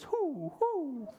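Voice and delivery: high hooting owl sound. Acted. Librarian reading a picture book hoots like the owl. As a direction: hoot it like an owl. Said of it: "Owl says".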